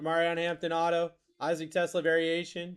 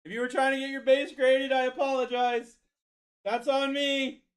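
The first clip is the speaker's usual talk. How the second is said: high, strained apologetic voice; over-the-top high-pitched apology; mock apology; 9 semitones higher, 4 dB louder than usual. Real, not acted. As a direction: plead high and hammed-up. Exaggerated apology to viewers.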